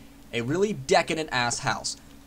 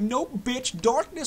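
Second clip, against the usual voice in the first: higher, sassy put-on voice for the nightfall; the game's night mocking the player, much higher; voicing the night; 8 semitones higher, slower than usual. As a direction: taunt like the darkness itself. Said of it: "It's like".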